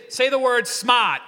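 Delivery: loud East Coast call with the R dropped; punchy, exaggerated East Coast accent. Shown as a demonstration. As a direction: lead the crowd in an East Coast "smaht". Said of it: "like you're from the East Coast".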